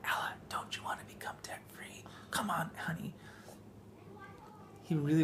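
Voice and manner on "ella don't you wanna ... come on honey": soft, hushed coaxing whisper; imitated whisper. Mom quoting son whispering to his sister, soft. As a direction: coax her in a whisper. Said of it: "out of our son's mouth".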